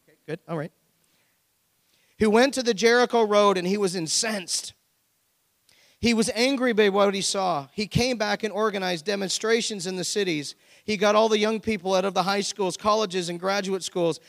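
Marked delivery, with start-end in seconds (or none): 2.2-14.2 loud, emphatic sermon story; preaching storytelling. Acted. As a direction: tell the parable at full preaching force. Reads parable with preaching force.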